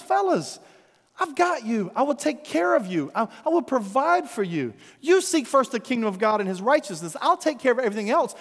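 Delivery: Jesus steadying his anxious friends; reassuring Jesus. Acted. Jesus reassures his disciples he will provide, tender.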